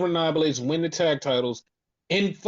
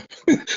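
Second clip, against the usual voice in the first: short laugh; 5 dB louder than usual. Real, not acted. Coy refusal to say more.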